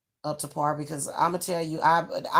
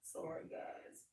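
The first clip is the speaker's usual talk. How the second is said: real hushed whisper, much softer; whispered aside; 21 dB quieter, slower than usual. Real, not acted. Whispered apology to viewers, breaking off mid-advice. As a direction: breathe a quick apology off-mic.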